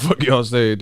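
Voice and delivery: normal talking voice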